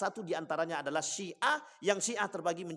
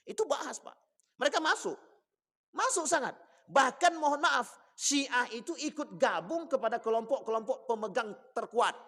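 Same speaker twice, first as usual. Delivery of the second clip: high-pitched, impassioned sermon voice; forceful preaching; 7 semitones higher, 5 dB louder than usual. Real, not acted. Preacher tells congregation of Iran's strength, forceful preaching. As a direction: hammer the political point, pitched high.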